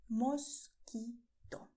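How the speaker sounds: "mosquito" very slow, mos-kee-to; pure Spanish o. Shown as a demonstration. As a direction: spell out "mosquito" syllable by syllable. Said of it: "this is the sound of it".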